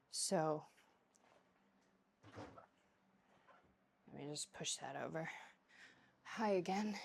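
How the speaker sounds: low and soft, close to the mic; soft, tired murmur after coming inside; hushed indoor talk. Real, not acted. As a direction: speak quietly as if not to disturb anyone. Talks softly inside.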